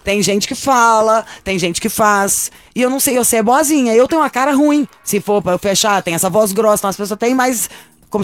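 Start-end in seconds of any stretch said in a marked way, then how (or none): 0.0-2.5 slow, stressing "fala" then "faz"; drawn-out punchline, "fala" and "faz" stressed; slow contrast. Shown as a demonstration. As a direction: spell out the contrast word by word. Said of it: "slow contrastive pair".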